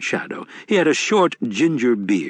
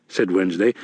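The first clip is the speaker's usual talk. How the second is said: usual speaking voice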